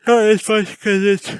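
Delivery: talking through a big yawn; words stretched by a yawn. Real, not acted. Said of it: "I could talk a little bit better when I was yawning".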